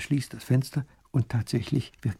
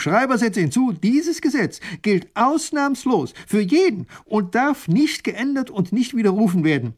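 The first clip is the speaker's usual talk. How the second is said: much higher, slow proclaiming; decree; 11 semitones higher, 5 dB louder than usual. Acted. Grand duke dictating law to the scribe, proclaiming. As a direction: declare it with full authority.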